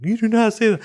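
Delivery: high-pitched mock scolding; scolding dad. Dad to child who repeated a word, scolding. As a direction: act out an indignant dad's telling-off.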